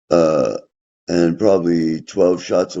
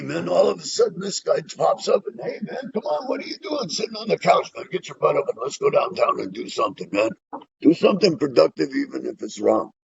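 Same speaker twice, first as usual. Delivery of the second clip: much higher, hyped-up pushy buddy voice; a wound-up inner voice nagging him off the couch; pushy restless self; 12 semitones higher, 3 dB quieter than usual. Retold or imitated. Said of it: "which one of me you're gonna get".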